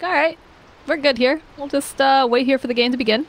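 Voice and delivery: voiced player thought. Commentator voicing a player waiting in the trees, lightly mock-casual. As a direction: play it as a lazy mock-casual inner voice.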